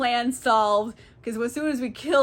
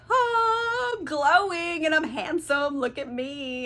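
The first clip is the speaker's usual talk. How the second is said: vain delight; 5 semitones higher, slower than usual. Retold or imitated. Odysseus sees himself handsome.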